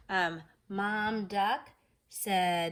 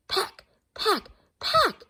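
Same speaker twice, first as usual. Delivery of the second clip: much higher, rising squeaky pecks; squeaky hatching pecks; 10 semitones higher, 4 dB louder, slower than usual. Acted. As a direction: peck higher each time like a chick breaking out.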